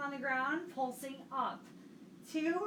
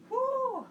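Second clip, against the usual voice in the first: high strained whoo; effortful whoop; 11 semitones higher, 5 dB louder than usual. Real, not acted. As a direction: let out a whoop through the burn. Exhaling hard during ab set.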